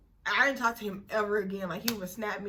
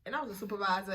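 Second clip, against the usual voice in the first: usual speaking voice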